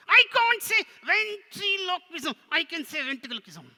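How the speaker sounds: high, loud puppet wail; protesting puppet. Pretend. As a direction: protest loudly as a frustrated puppet. Puppet protests about hard word.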